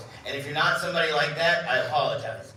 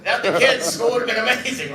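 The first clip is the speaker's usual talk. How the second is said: higher, louder, laughing fix of the joke; laughing correction; 4 semitones higher, 6 dB louder than usual. Real, not acted. Jokey correction of the quote.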